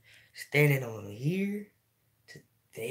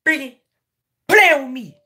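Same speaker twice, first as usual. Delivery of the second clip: loud tough demand; 11 semitones higher, 12 dB louder than usual. Acted. Mock tough talk to chat.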